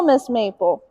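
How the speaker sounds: ordinary talk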